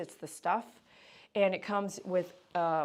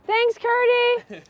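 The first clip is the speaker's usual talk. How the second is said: much higher, louder sing-song cooing; 12 dB louder, much slower than usual. Real, not acted. Groomer gushing to the happy dog that daddy arrived, high baby talk.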